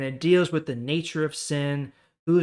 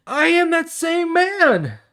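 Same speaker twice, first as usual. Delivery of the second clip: much higher and slow, emphatic; insistent healed man; 10 dB louder, slower than usual. Acted. Healed man telling the doubting crowd, slow emphatic declaration. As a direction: plant each word, proudly.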